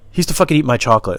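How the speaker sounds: normal talking voice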